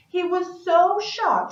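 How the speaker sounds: surprised. A narrator's reading. Dragon surprised.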